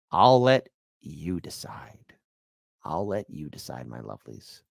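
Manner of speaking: breathy hushed sign-off, slow and soft; teasing whisper. Acted. Host teasing his viewers in a slow whisper. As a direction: lean in and whisper it to the audience.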